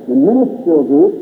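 ordinary talk

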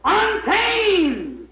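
high, drawn-out cry from the pulpit; fervent, high-pitched exclamation; preacher's cry. Real, not acted. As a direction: cry it out high and long to the congregation. Preacher cries out the key word.

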